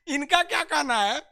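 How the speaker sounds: amused laughing. Real, not acted. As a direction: speak through a laugh. Laughing at student.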